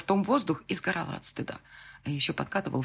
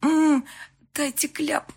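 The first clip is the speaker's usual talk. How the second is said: overwhelmed cry; 11 semitones higher, 5 dB louder, much slower than usual. Acted. Overwhelmed outburst.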